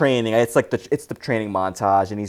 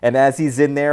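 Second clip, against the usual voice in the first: normal talking voice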